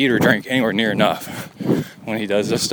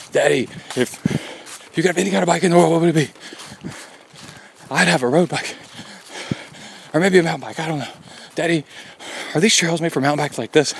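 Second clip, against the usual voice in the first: higher imitated chatty kid voice; excited kid; 5 semitones higher than usual. Father imitating hyper son asking about bikes, higher and chatty. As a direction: rattle off eager kid questions. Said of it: "and then asking all kinds of crazy questions, like".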